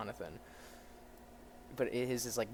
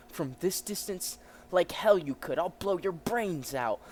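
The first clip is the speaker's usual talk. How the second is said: acted angry threat; 6 semitones higher, 6 dB louder, slower than usual. Cop threatens to shoot in story.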